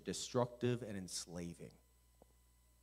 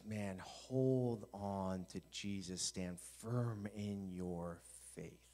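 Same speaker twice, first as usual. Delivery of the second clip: slow, drawn-out urging, slightly low; earnest exhortation; 3 semitones lower, 5 dB quieter, much slower than usual. Retold or imitated. Peter urging believers, slow earnest appeal. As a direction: urge them word by stretched word. Apostle's plea voiced slowly to congregation.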